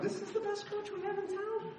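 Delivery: high, disbelieving voice; incredulous. Retold or imitated. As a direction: ask in disbelief. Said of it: "I'm like".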